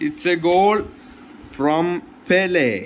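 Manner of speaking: loud, high, stretched goal call; malayalam english mix. Real, not acted. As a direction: announce the goal like a thrilled commentator. Celebrating his own goal. (ആവേശത്തോടെ goal പ്രഖ്യാപനം)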